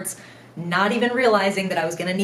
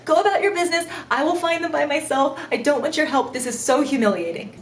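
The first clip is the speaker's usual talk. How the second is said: higher, embarrassed begging; mortified pleading; 6 semitones higher than usual. Retold or imitated. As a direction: beg them to stop helping, mortified. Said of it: "I'm so humiliated".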